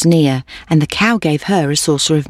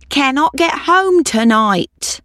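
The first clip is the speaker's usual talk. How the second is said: high, slow storybook lament; plaintive old woman; 10 semitones higher, slower than usual. Acted. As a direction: draw out the old woman's plea, pitched high. Old woman's rhyming plea.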